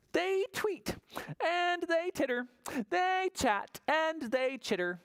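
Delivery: storyteller mimicking birdlike chatter; high chattery read. A narrator's reading. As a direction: bounce through the party noises. Reading animal chatter noises.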